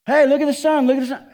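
high, loud hammed-up excitement; mock excited. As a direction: shout it like a giddy kid. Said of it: "Yeah, well, we".

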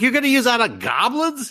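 high, disbelieving question, mocking the party; incredulous. Party members stunned that he'd save his spell for goblins. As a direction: pitch it way up in disbelief. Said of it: "They were like".

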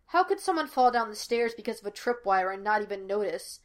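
ordinary delivery, nothing marked

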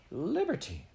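high delighted exclaim; lady recognising her cousin's gift, delighted; delighted cry. A narrator's reading. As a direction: burst out in glad recognition. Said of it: "cried Miss Peasmarsh".

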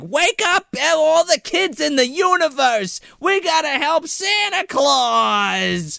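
high, strained yell held below full volume; drawn-out, high-pitched pretend shout; mock screaming. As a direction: imitate a kid hollering down the street, stretching the last words. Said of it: "screaming at the top of his lungs".

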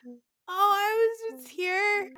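put-on high, drawn-out answer to a child; slow, high retold voice; imitated sweet reply. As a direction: play a gentle, sing-song reply. Retold reply to the little boy.

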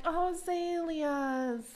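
dreamy Linda. Retold or imitated. Linda swooning over flowers.

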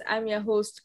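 ordinary talk, nothing marked

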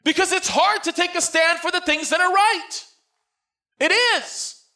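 loud, high-pitched preacher's cry; preaching at full force. Acted. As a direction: preach it out to the whole church.

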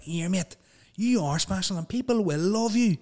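high-pitched mock motivational speech; hammed-up encouragement, pitched high; mock inspirational. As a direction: parody an uplifting influencer message. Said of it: "all these, like, inspirational".

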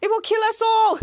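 mock-panic about certain death; panicked. [name] warning everyone, panicked. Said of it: "[name] going into panic".

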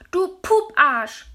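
put-on high, slow child's scream. A narrator's reading. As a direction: scream the rude word at him, drawn out. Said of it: "hat er geschrien".

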